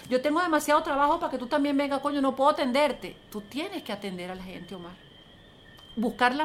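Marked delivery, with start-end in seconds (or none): 0.1-3.2 higher, louder exasperated complaint; irritated producer. Retold or imitated. A producer turning away a crew member, mock exasperated. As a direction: play the overwhelmed boss brushing someone off. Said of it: "Yo he escuchado productores que hacen eso".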